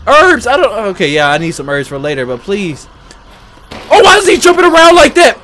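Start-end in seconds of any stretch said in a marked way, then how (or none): 3.9-5.4 high, loud and fast; frantic rushed yelling; panicked. Real, not acted. Chased by jumping monster.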